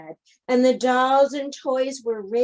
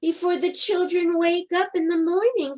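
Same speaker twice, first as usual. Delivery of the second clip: higher, urgent toys; urgent plea; 7 semitones higher, faster than usual. A narrator's reading. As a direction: press her, time is short. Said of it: "said all the dolls and the toys".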